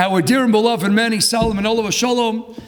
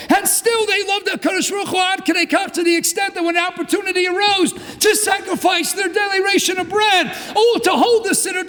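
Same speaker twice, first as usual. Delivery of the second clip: full-force preaching; 7 semitones higher than usual. Real, not acted. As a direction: preach it out to the whole shul with fire. Rabbi preaching with rising fervor.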